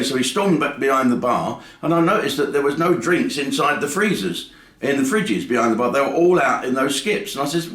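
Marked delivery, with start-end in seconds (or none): none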